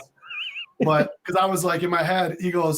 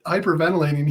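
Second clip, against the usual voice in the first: normal talking voice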